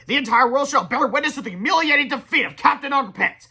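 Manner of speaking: loud, high, gloating villain voice; booming villain. A narrator's reading. Villain boasting grandly.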